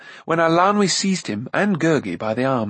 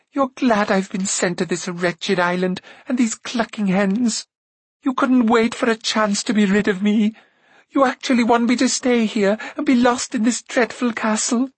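hurt accusation climbing steadily higher; tearful outburst; 10 semitones higher than usual. A narrator's reading. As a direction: build from wounded to near-wailing, pitch very high by the end. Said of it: "Tears suddenly filled her eyes".

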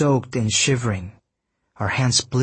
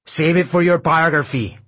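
raised, louder taunting call; calling after, mocking; 6 semitones higher, 5 dB louder than usual. A narrator's reading. Sarge jeers after the dragged-off intern, calling out. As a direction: toss a jeer after someone being hauled away. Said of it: "Sarge called after him, laughing".

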